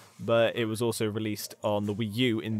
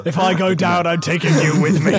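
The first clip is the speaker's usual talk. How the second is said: mock threat; 5 semitones higher, 7 dB louder than usual.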